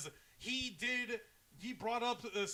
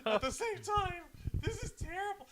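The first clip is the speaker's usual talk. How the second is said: laughing high voice; 11 semitones higher than usual. Real, not acted. Laughing reaction.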